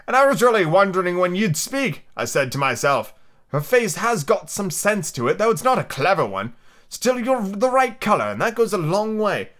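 high, slow, drawn-out flower voice; haughty Rose. Acted. The Rose appraising Alice's looks, high haughty drawl. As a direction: judge her in a high, leisurely voice.

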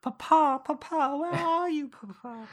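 high, wailing call for Papa; mock horror heroine. Co-host mimics the sixties horror heroine wandering the house, high melodramatic call.

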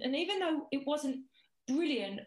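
usual speaking voice

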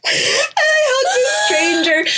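very high, squealing through laughter; helpless laughter. Real, not acted. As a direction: tell it while cracking up, voice way up high. Still laughing at her own story.

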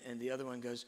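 ordinary talk

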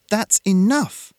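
high, loud, drawn-out order; loud command. A narrator's reading. Girl stopping the crowd around her friend, loud. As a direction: cut the crowd off loudly. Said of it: "she said loudly".

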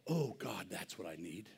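usual speaking voice

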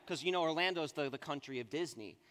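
ordinary delivery

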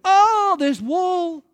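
mock despair at hitting a wall; exasperated groan. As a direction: cry out like a man stuck at a wall. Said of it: "he was like".

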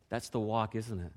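normal talking voice

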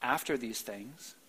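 hushed rising "After these things"; quiet. Shown as a demonstration. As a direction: drop it away as the wrong reading. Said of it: "He doesn't say".

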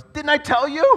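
hammed-up king throwing up his hands; exasperated king. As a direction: Ahab venting to his court, shrill and loud. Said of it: "Ahab goes".

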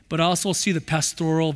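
ordinary talk, nothing marked

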